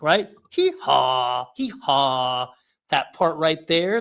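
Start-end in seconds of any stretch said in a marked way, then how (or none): 0.5-2.5 hee-haw brayed like a donkey; playful donkey hee-haw. Shown as a demonstration. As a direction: bray it out like a donkey. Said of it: "what a donkey sounds like".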